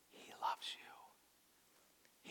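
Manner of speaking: soft, slow whisper; whispered, unhurried assurance; hushed reassurance. Real, not acted. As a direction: let it drop into a whisper. Hushed repetition of the key truth.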